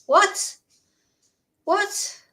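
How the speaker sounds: high, rising disbelief; baffled question, voice shooting upward; stunned, questioning yelp without shouting; incredulous. Acted. Reacting in disbelief to the quoted reply.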